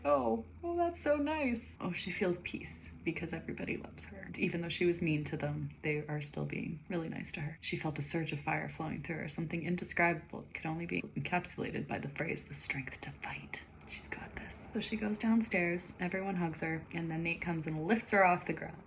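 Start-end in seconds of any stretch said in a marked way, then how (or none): 0.0-1.8 touched. Retold or imitated. Lexi touched by hugs. 12.4-14.7 dramatic whisper. Real, not acted. Dramatic phrase from the book.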